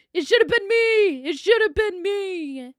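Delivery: over-the-top jealous wail; dramatic shout. Pretend. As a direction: belt it out like a wronged diva. Jealous outburst over birthday.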